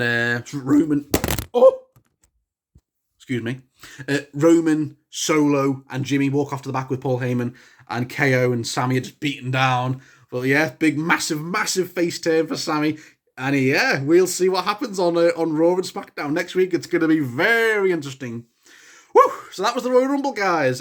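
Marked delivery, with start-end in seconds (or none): 1.5-1.8 high, loud startled cry; sudden yelp. Real, not acted. Sudden cry, then excuse me. 19.1-19.3 loud joyful woo; celebratory whoop. Real, not acted.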